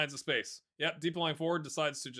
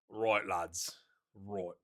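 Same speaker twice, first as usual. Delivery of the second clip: a hint of a put-on Scottish accent, lower; light Scottish accent; 6 semitones lower, much slower than usual. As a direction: say it like a Scottish manager rallying his players. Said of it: "that you like the Scottish accent".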